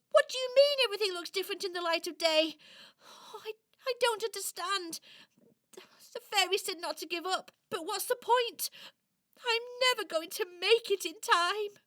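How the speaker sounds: fast, fretful outburst; distressed. Acted. Toy left alone fearing she won't make it, distressed. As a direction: rush it out in rising despair. Toy frets after fairy leaves.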